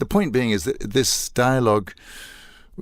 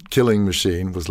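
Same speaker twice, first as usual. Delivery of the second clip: normal talking voice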